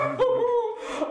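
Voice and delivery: high, loud laugh that breaks into a cry; tearful laugh. Real, not acted. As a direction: laugh cracking into tears. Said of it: "I was scared".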